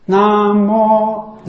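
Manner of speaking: 南无 bound together; joined syllables. Shown as a demonstration. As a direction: say 南无 as one linked unit. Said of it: "两个字连在一起".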